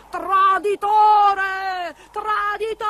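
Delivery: high, long-drawn witch shriek; loud, stretched cry of rage; enraged scream. A narrator's reading. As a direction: howl the accusation in fury. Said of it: "Con un grido di rabbia".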